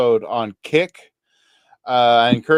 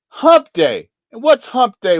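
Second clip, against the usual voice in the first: excited shout; 9 semitones higher, 5 dB louder than usual. Acted. Excited hump day shout.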